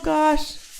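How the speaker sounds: ordinary talk, nothing marked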